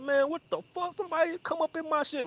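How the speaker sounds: high, mock-indignant rant; hammed-up high-pitched complaining; talking shit. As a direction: imitate a friend grumbling in a high voice. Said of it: "he was talking shit".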